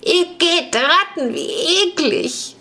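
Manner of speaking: high, slow voice full of disgust; trembling girl's disgusted cry; disgusted shudder. Acted. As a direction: squeal out your revulsion at the rats. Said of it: "zitterte am ganzen Körper vor Abscheu".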